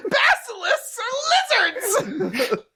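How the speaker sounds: high, drawn-out tantrum wail; whine-shout it through laughter; hammed-up sobbing outrage; whiny asshole. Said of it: "I just turned this whiny asshole".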